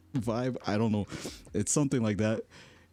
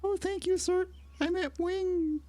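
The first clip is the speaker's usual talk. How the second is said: much higher, slow, squeaky; mock kid voice. Mocking a polite kid who calls him sir, high squeak. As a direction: parody the formal kid, drawn out.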